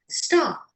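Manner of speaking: STAR said without its final R, "stah"; non-rhotic "stah" for STAR, drawn out; dropped R. Shown as a demonstration. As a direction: let the end of STAR open into a long "ah". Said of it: "drop the rhoticity at the end".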